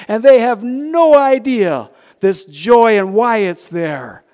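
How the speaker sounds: loud, high preacher's exhortation; preaching at full force. Acted. Preacher rousing his congregation, loud full-force preaching. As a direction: lift the room, preach it out.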